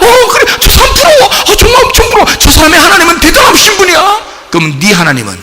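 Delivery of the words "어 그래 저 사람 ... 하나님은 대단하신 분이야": mock someone swooning over another's God; envious churchgoer. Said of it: "그러니까 사람들이 막".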